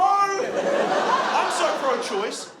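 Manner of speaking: stretched shout. Acted. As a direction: drag it out, hammed-up. Drawn-out shouted line.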